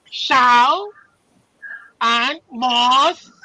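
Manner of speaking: ordinary delivery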